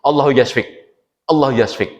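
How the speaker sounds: Allahu yasfik in a throaty native Arabic accent. Shown as a demonstration.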